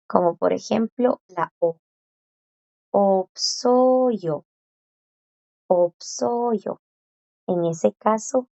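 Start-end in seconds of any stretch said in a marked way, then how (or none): 2.9-4.4 "Opsoyo" with the S clearly sounded before the vowel; S audible. Shown as a demonstration. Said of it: "claro que la S suena". 5.7-6.8 "Opsoyo" with the S clearly sounded before the vowel; S audible. Shown as a demonstration. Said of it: "claro que la S suena".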